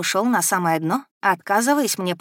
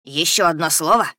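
gurgling threat through water; 4 dB louder than usual. A narrator's reading. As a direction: gurgle the words menacingly. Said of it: "пробулькал Эдди".

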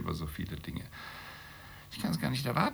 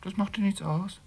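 incredulous echo; 12 semitones higher than usual. Acted. Sceptical daughter echoing Ada's words, incredulous and high. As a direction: pitch leaps higher in disbelief. Doubting the other's claim.

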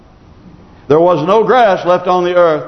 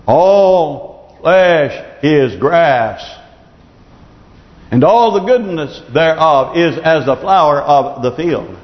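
slow scripture reading; 3 semitones lower, slower than usual. Acted.

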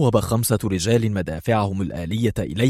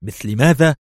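tense question; 4 semitones higher, 6 dB louder than usual. A narrator's reading. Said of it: "سأله برونو في توتر".

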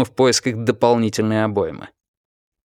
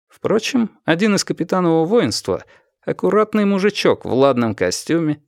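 usual speaking voice

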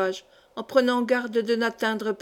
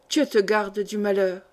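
ordinary delivery, nothing marked

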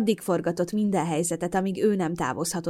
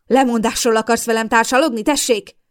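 furious howl, loud and higher; 4 semitones higher, 8 dB louder than usual. Acted. Mother howls at her daughter before smashing the pie. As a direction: howl it at her full force. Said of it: "üvöltötte".

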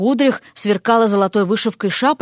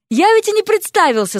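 higher-pitched, flustered remembering; sudden realization; 9 semitones higher than usual. A narrator's reading. Young man realizes he forgot introductions, flustered. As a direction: catch yourself in a lapse. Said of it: "спохватился молодец".

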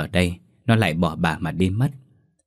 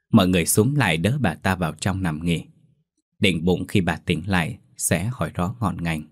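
ordinary delivery

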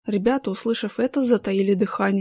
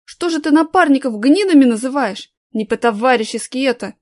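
snapping back; 3 semitones higher, 7 dB louder than usual. A narrator's reading. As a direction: snap back at him hard. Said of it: "огрызнулся третий".

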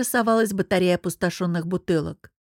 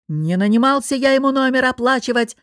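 raised, louder angry boss voice; yelling; 4 semitones higher, 5 dB louder than usual. A narrator's reading. Boss refusing to pay for the guest's room, yelling. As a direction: yell it in indignation. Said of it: "заорал босс".

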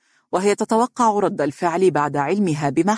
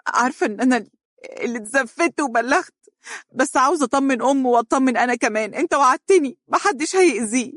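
high, choked, fast confession; crying young woman, voice choking; tearful; 8 semitones higher, faster than usual. A narrator's reading. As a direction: plead through tears. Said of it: "تحشرج صوتها وقالت ببكاء".

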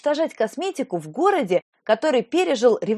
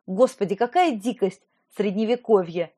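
plain reading voice

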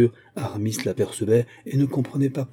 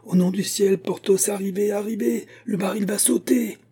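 frantic pleading; 10 semitones higher than usual. A narrator's reading. Aramis begging Porthos before explosion.

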